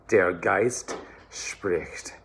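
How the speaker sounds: slow, careful German; German diction demo. Shown as a demonstration. Choir coach modelling the phrase, slow careful German. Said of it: "And then super fun".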